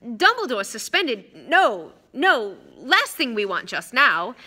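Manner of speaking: alarmed official, swooping on each no. Acted. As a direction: splutter in dismay at the idea. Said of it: "looking alarmed".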